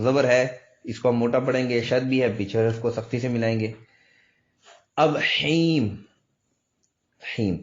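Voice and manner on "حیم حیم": "حیم" very slow with a long held ee; stretched syllable demo. Shown as a demonstration. Teacher models the syllable for students, stretched recitation. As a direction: draw out "حیم" from the throat.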